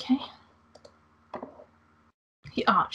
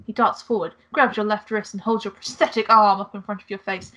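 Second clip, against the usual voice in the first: usual speaking voice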